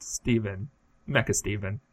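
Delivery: normal talking voice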